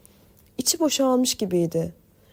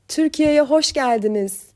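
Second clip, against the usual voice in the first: loud, higher joyful shout; shouting at full voice; 5 semitones higher, 5 dB louder than usual. Acted. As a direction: yell the welcome as loud as you can. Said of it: "diye bağırdı koridorda avazı çıktığı kadar". (avazı çıktığı kadar bağırış)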